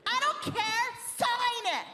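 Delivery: very high, insistent demand; pushy dancer. Acted. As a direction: imitate a bossy fan in a high voice. Dancer demands an autograph, acted.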